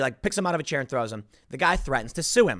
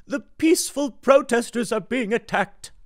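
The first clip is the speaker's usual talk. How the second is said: mocking Democrats; 7 semitones higher than usual. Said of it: "the Democrats".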